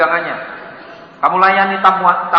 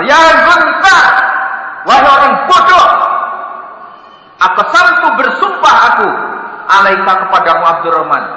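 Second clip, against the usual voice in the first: louder, higher, heated scolding; angry shouting call; 6 semitones higher, 5 dB louder than usual. Acted. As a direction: yell at the hiding son. Abu Bakar marah memanggil. (Abu Bakar membentak anaknya dengan keras)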